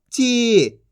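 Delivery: ची with a held ī; long drawn-out vowel. Shown as a demonstration. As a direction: stretch ची into a long "cheee". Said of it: "खींच के पढ़ा जाता है".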